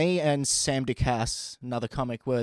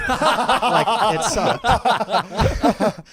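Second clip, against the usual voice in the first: higher, louder, laughing through the words; 9 semitones higher, 7 dB louder, much slower than usual. Real, not acted. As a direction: laugh as you say it sucked. Laughing at her bad impression.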